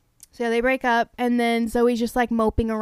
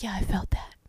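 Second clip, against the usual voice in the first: whispered; 5 semitones lower, 6 dB quieter than usual. Acted. Voicing Chase after kiss.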